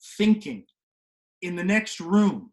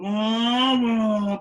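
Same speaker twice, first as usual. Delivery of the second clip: engine noise; 2 semitones higher, 5 dB louder than usual. Retold or imitated. Imitating engine.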